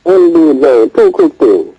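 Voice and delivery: muffled phone line; muffled as if under water. Real, not acted. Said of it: "Why you sound muffled".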